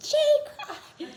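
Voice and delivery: high startled exclamation; mock yell. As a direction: yelp it out in alarm. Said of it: "but he goes".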